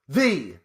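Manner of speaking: "the" said as a long, set-apart "thee"; stress on THE. Shown as a demonstration. Host naming Ohio State with its obligatory article, "the" stressed as "thee". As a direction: hit "the" as "thee" before the name. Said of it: "Always have to say "the".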